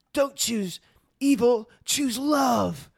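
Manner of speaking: loud, high, drawn-out mock-heroic cry; mock-dramatic shout; hammed-up plea. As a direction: belt it out as an overblown movie plea. Hammed-up movie plea from Thor.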